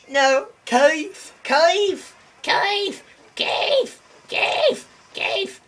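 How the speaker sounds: very high, strained calls of a name; screechy falsetto call; high-pitched calling. Pretend. Calling to Keith in game.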